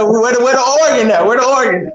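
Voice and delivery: laughing joke. Retold or imitated. Man joking about preaching to his friends, laughing. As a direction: crack up while asking for the organ. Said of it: "I said".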